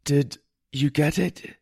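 halting, effortful question; struggling to speak. A narrator's reading. Poisoned boy to his healer, straining to speak. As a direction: push words through a fouled throat. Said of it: "I pushed through it to speak".

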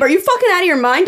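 high, fast, exasperated yell; imagined outburst. Retold or imitated. Imagining yelling at her husband at the garage button, a high fast yell. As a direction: snap it at him fast and high. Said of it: "I'd be like, 'Babe".

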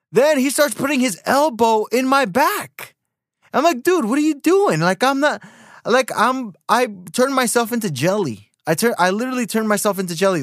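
high, incredulous retelling; worked-up protest. Retold or imitated. Recounting his protest at the elbow.

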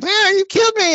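put-on very high, squeaky child voice; little kid voice. Little kid in the game complaining about being killed, squeaky high voice. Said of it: "some little fucking kids".

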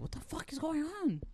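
high, confused exclamation; imitating a baffled customer, high-pitched; mock bewildered outburst. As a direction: squeak it out baffled.

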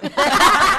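laughing about the wrong vegetables in the soup; loud laugh. Real, not acted. Cracks up over the story.

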